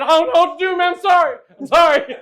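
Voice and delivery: high, loud frantic apologizing; panicked apology. Retold or imitated. Comedian reliving dragging a stranger downhill, frantic shouted apology. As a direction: yelp out sorry in a panic.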